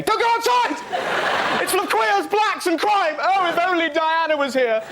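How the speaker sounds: very high, wailing mock panic; hysterical newspaper. Express terrifies its readers, high hysterical mock wail. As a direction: shriek warnings like a hammed-up alarmist. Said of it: "the unremitting horror of the Daily Express".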